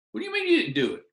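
high-pitched incredulous challenge; mock outraged disbelief; exasperated coach. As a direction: jump up in pitch, demanding an explanation. Coach's acted quarrel with pupil.

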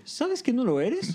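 high, drawn-out acted surprise; mildly hammed-up astonishment; surprised. A narrator's reading. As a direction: ask slowly in high-pitched surprise. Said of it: "Troy, sorprendido".

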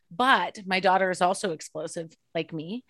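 normal talking voice

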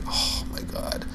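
breathed out under the breath, fed up; voiceless, exasperated oh my god; exasperated whisper. Retold or imitated. Annoyed at dad's backseat driving.

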